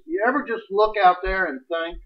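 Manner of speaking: normal talking voice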